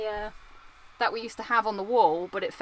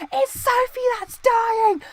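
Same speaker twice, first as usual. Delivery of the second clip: very high, slow cry that Sophie is dying; high panicked quote; 14 semitones higher, 5 dB louder, slower than usual. Retold or imitated. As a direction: squeal it high like a panicked man from a TV show. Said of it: "Returnal clip where I'm talking about".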